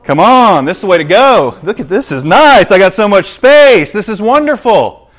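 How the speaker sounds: loud, high, coaxing sales pitch; the world tempting loudly; enticing world. Acted. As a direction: lure them in with big enthusiasm. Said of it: "the world says".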